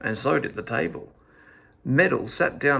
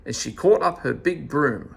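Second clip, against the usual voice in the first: ordinary delivery, nothing marked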